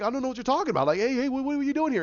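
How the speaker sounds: high, quick-stumbling bewilderment; flustered protest. Retold or imitated. Captive protesting to his captors, flustered.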